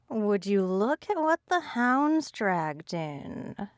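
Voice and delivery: slow, purring menace; low purr. A narrator's reading. Jailed rival greets her enemy in a slow menacing purr. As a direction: purr it out slowly, savoring the threat. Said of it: "such a low purr".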